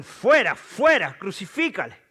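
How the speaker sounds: high, loud bursts from a mob; crowd shouting. A narrator's reading. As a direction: shout each demand as an angry crowd. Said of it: "Pero ellos gritaron". (a gritos, como la turba)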